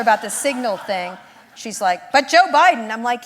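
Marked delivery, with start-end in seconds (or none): 2.1-2.9 mom's stock retort. Retold or imitated. Mom's stock reply about Signal.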